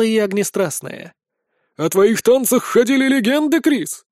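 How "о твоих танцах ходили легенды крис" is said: high, eager praise; admiring crowd call. A narrator's reading. Tables hailing the dancer, admiring call. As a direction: hail a legend from across the hall. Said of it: "заявляют со столов".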